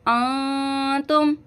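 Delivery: अंतुम with the न hidden and hummed through the nose; nasal ghunna. Shown as a demonstration. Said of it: "इख्फा का गुन्ना करेंगे, नाक में आवाज़". (अंतुम में नाक से गुन्ना)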